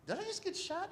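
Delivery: high, soft bewildered voice; dazed question. Retold or imitated. Waking patient asks sheriff, high soft bewilderment. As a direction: ask it small and high, confused.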